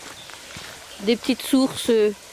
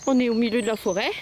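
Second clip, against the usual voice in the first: ordinary talk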